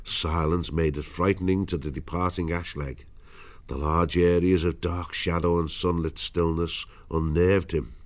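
plain reading voice

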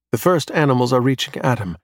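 plain reading voice

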